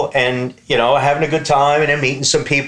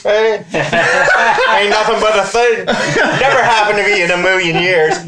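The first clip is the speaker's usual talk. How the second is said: laughing boast; 8 semitones higher, 3 dB louder than usual. Retold or imitated.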